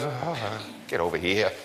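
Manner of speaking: a father waving you in close; gentle welcome. Retold or imitated. God calling a sinner home, softly and tenderly. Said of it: "God just looks at you and goes".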